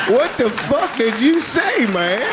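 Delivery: high, slow, incredulous; baffled outburst. Retold or imitated. Comic to stranger on street, high and baffled. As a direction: act stunned by the reply. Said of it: "retold reaction".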